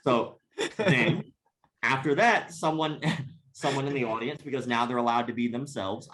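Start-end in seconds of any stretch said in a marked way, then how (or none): 0.0-1.2 real laughter. Real, not acted. Laughing at the stabbings.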